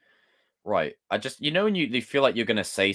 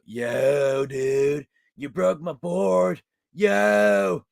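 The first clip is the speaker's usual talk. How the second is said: American dude voice, higher and drawn out; American accent; 7 semitones higher, much slower than usual. Shown as a demonstration. Streamer does American accents on request, complaining dude caricature. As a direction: climb higher as an aggrieved American board dude. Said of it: "do more American accents".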